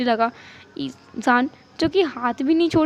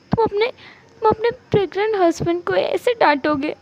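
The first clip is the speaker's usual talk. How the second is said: high, mock-weepy reproach; hurt, tearful accusation; 6 semitones higher than usual. Pregnant spouse reproaching husband for scolding, theatrically hurt. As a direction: play up the wounded spouse.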